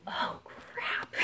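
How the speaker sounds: breathy whispered dismay, almost silent; whispered oh crap. Retold or imitated. Caught out by her mother-in-law, a dismayed whisper. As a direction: let it slip out under your breath.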